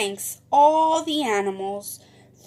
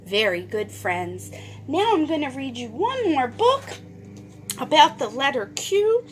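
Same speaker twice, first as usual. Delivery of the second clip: ordinary talk, nothing marked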